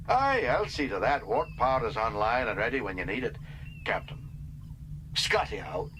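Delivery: lightly voiced, cheerful can-do engineer; Scotty promises Kirk warp power; Scotty's voice.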